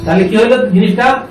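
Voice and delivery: ordinary talk, nothing marked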